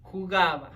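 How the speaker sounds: Jugaba said loud and clear, hoo-GAH-bah; pronunciation model. Shown as a demonstration. Leading students to say it along, Jugaba clearly modelled. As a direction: model Jugaba for repetition, falling at the end. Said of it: "let's practice the pronunciation".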